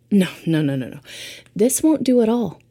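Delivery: ordinary talk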